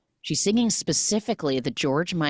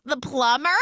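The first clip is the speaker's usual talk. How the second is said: high, questioning bluff; sheepish excuse; 9 semitones higher than usual. Retold or imitated. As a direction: offer it as an unsure, acted guess. Acting caught woman's feeble excuse.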